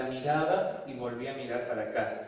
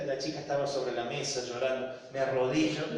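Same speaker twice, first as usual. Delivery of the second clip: ordinary talk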